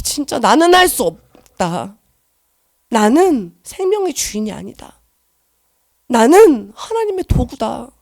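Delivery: slow, deliberate surrender; slow confession. Retold or imitated. Confessing to God she is only His tool, slow and solemn. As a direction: weigh each word of surrender slowly.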